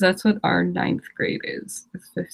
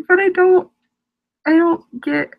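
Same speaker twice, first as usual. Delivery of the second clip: higher, louder heated sputter; 9 semitones higher, 5 dB louder than usual. Real, not acted. Ranting to viewers about separate blogs, heated and sputtering. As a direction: sputter it out, getting worked up. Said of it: "Getting, like, really heated".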